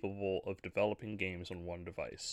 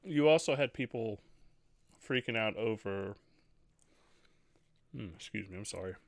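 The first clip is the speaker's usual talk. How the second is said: eating mid-sentence; chewing while talking. Real, not acted. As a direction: mouth full of food. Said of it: "I'm trying to eat and do the podcast".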